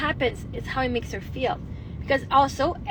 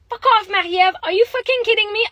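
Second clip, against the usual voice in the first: loud, higher angry outburst; furious at herself; 9 semitones higher, 8 dB louder than usual. Retold or imitated. Woman retelling scolding herself in car, angry shout. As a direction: snap at yourself in full anger. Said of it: "I was so fucking pissed off at myself".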